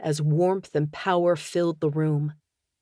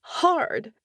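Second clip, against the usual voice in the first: voice breaking; 9 semitones higher than usual. A narrator's reading. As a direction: voice cracks high on the word. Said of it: "her voice broke".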